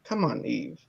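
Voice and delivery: low, very quiet mock coaxing; hushed, low movie quote; soft coaxing. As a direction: imitate a husband wheedling under his voice. Voices husband coaxing wife.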